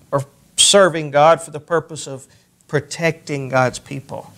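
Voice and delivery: ordinary talk, nothing marked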